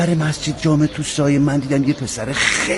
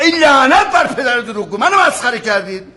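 higher, louder, fed-up curse; angry curse; 10 semitones higher, 4 dB louder than usual. Acted. Officer cursing the men he thinks mock him, angry. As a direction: blow up at being made fun of.

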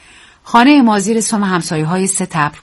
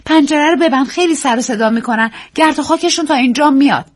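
Daisy's voice; 8 semitones higher than usual. Acted. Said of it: "گفت".

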